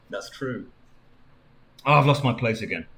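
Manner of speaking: plain reading voice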